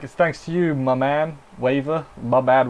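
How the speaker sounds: normal talking voice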